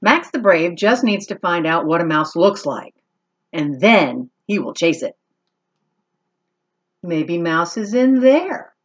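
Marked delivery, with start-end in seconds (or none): none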